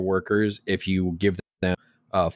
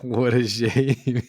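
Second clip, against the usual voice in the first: slow, mock-pitying drawl; teasing mock pity; sarcastic; 4 semitones higher, much slower than usual. Real, not acted. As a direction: drag it out in fake sympathy. Teasing fiance sarcastically at duration.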